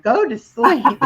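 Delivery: laughing, stretched-out pretend command; retold self-scolding, laughing. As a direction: order yourself drawn-out, tipping into a laugh. Retelling phone bopping her awake.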